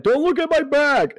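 high yelled cry; shouting. Retold or imitated. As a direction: yell it out in a panic. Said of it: "the other dude that pulled Sakura out was like".

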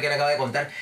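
normal talking voice

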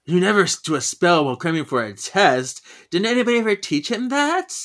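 exasperated telling-off, climbing at the end; acted scolding. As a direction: play an indignant lecture rising to a high question. Piper scolding about a spell.

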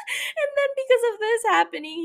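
high-pitched words through laughter; laughing while talking. Real, not acted. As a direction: keep talking as the laugh takes over. Laughing at her own rant.

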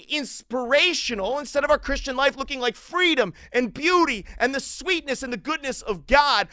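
impassioned sermon delivery; forceful preaching. Real, not acted.

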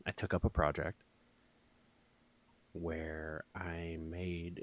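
quiet, slow, deadpan boasting; deadpan mock pride. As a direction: present it like groundbreaking art, dryly. Mock-proud craft story.

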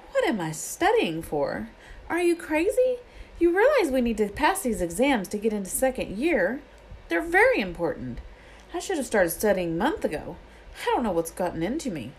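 high, fretful Hermione voice; anxious schoolgirl. Acted. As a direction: panic about exams, rising high. Hermione panicking over exams.

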